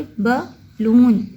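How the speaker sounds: बलून slowly split as ब, लून; two syllables. Shown as a demonstration. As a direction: pause between the two halves of बलून. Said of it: "ये two syllable word है".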